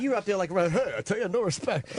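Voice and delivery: mildly mocking imitation of a bad act; retold mocking. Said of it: "he's making fun of me".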